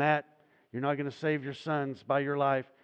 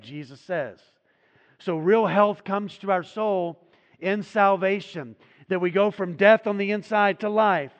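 louder and higher, driving the message home; full-force preaching; 4 semitones higher, 7 dB louder than usual. Acted. Pastor urges the congregation toward salvation, full-force preaching.

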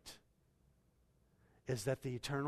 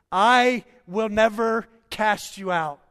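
loud, higher, slow proclamation; proclaiming loudly; 8 semitones higher, 16 dB louder, slower than usual. Acted. Pastor voicing Jesus' promise to believers, loud proclamation. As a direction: thunder it from the pulpit.

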